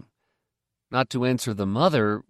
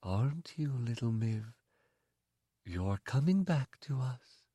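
much softer, gentle and loving; tender; 9 dB quieter than usual. Acted. The mother holds little Miv, tenderly and softly. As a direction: coo it to a child on her lap. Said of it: "she spoke tenderly".

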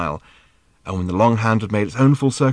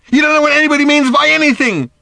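very high, loud retort; furious shout; 7 dB louder than usual. A narrator's reading. Daughter in a row with her father, shouting furiously. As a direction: scream it back at him.

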